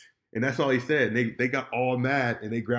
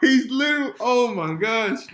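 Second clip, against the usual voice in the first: high, drawn-out words through real laughter; laughing; 11 semitones higher, 8 dB louder, slower than usual. Real, not acted. Laughing to his friend about Jon's blunder. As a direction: laugh it out slowly, can't believe it. Laughing at how dumb Jon is.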